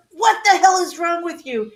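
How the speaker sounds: retold outraged question; exasperated. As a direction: act out fed-up disbelief at a laughing husband. Retells yelling at laughing husband.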